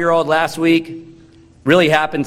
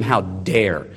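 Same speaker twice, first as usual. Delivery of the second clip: muttered accusation; 7 semitones lower than usual. Retold or imitated. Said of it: "You, you accuse them".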